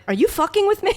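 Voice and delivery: high, incredulous, voice dropped; blunt disbelief. Retold or imitated. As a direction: drop the politeness and demand it. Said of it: "you dropped it and went".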